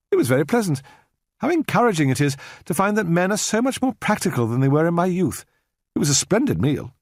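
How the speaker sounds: a Victorian lady making polite conversation; courteous, distant spinster; prim elderly lady. A narrator's reading. Miss Hunt, dated southern lady.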